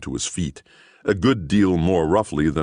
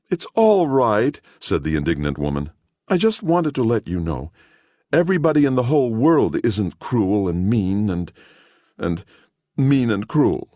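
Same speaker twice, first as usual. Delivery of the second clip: put-on earnest older woman; 7 semitones higher than usual.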